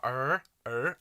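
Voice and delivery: "er" with the tongue curled back; American R. Shown as a demonstration. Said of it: "like that".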